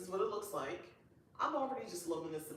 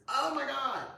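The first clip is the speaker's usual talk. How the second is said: excited gasp; 8 semitones higher, 8 dB louder than usual. Real, not acted. Excited by pictures.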